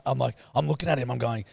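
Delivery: ordinary talk, nothing marked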